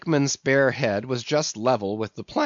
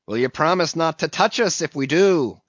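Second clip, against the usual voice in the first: demanding; 5 semitones higher, 4 dB louder than usual. Acted. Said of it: "demanded their ringleader".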